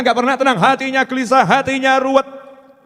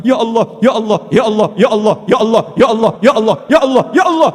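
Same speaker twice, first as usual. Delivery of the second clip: insistent repeated calling on God; urgent rhythmic supplication; begging to God. Acted. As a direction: cry out to God over and over, building at the end. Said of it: "sudahkah kita mengemis seperti itu?".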